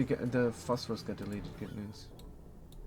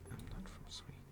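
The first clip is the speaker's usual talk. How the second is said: whisper; 16 dB quieter, faster than usual. Pretend. Repeats denial to chat, hushed.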